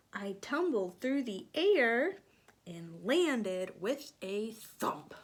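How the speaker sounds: animated storybook reading; reading to children. A narrator's reading. Teacher reading aloud to children. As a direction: read it lively for little ones.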